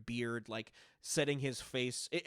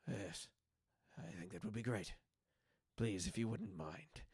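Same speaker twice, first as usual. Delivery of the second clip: low, soft Marith; 3 semitones lower, 7 dB quieter than usual. Acted. GM voices Marith replying.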